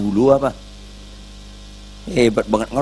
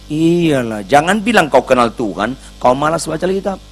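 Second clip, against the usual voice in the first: usual speaking voice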